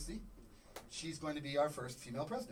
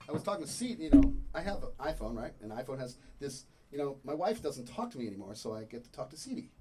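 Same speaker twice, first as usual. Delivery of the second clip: usual speaking voice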